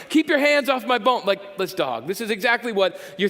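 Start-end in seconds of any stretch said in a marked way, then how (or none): 0.1-1.2 higher and louder, defensive snap; guard it like a dog with a bone; mock protective outburst. Pastor mimicking a defensive listener, mock protective outburst. Objector guarding his sex life.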